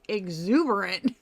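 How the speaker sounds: drawn-out questioning EXUBERANT; slow, incredulous echo of an odd word; puzzled disbelief. Retold or imitated. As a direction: question it slowly, as if unfamiliar. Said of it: "That is not a word I use".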